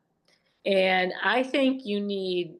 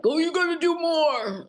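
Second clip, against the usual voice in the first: pushy male trainer; 11 semitones higher, 4 dB louder than usual. Retold or imitated. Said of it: "It's not like".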